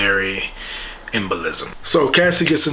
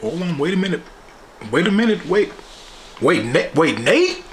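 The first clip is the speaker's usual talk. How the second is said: higher, halting double take; Maddie realizing; 6 semitones higher than usual. Retold or imitated. Maddie catching Nate at the door, high and stunned. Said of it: "she's like".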